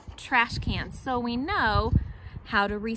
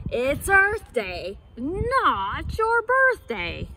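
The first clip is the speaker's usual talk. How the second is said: louder, slow hippo boom; loud hippo cry; 2 semitones higher, 6 dB louder, much slower than usual. Acted. Hippo answers Monkey from the riverside, loud slow cry. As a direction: bellow it slowly across the river. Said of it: "loudly cried".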